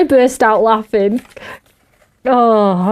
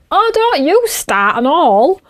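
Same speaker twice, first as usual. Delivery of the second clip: higher, slow mock exasperation; mock warning; 7 semitones higher, slower than usual. Mum warning the dad off, mock exasperated. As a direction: tell him off with a grin. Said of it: "I'm like".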